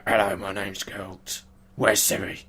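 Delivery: a lightly voiced gruff hero; a hint of a brooding monster hunter; mock hero looking for Ciri; bad Geralt impression. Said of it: "your bad impression of him".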